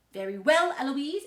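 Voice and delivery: loud retort, rising on the name; tutor's retort. A narrator's reading. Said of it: "he stands up and he says".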